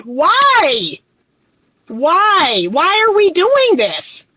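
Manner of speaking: high, pleading, bewildered why; exasperated disbelief. Real, not acted. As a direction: throw up her hands at it. Exasperated rant about educated people.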